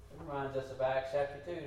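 normal talking voice

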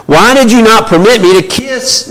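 high, loud indignant outburst; blustering Laban. Acted. Laban blustering at Jacob, loud high indignation. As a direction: bluster at him, wounded and indignant. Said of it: "he is on a bluster right now".

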